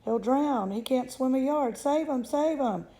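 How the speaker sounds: high, frantic pleading; rising mock panic; panicked cry. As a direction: beg desperately for help. Mother panics, child drowning.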